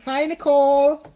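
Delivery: loud, high hello to a viewer; excited greeting. Real, not acted. Greeting a friend who joined the live, excited call. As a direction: call out a bright welcome.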